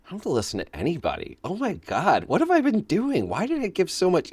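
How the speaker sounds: a touch of mock revelation; sudden realization. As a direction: realize it all at once, amazed. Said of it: "we're like".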